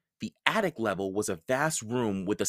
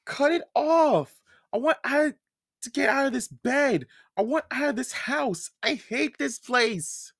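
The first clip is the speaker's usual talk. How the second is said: mild sobbing plea; 3 dB louder than usual. A narrator's reading. Mallory begs her mother to cut her free, tearfully. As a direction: let a little crying tug at each line. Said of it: "Mallory sobbed".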